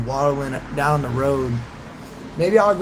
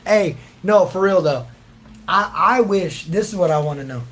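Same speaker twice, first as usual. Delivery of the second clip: ordinary talk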